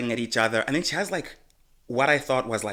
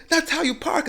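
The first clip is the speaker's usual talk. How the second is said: high mock voice; mocking him. Mocking the man's parking claim, high voice. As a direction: mimic him, high and smug. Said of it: "He's like".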